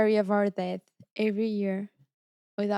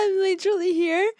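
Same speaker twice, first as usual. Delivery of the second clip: high, loud indignant reply; mock outrage; 8 semitones higher, 5 dB louder than usual. [name] protesting to her teammates, mock-shocked. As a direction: act stunned and offended. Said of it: "I was like".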